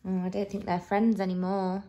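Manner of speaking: low, soft, quick commentary; hushed aside. A narrator's reading. Reader to children as friends part, hushed. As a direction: murmur it on an even, low note.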